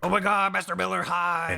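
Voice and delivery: mock-excited fan greeting. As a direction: greet an old idol excitedly. Snake greeting his old sergeant.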